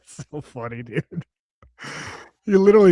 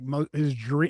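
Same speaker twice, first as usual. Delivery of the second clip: ordinary delivery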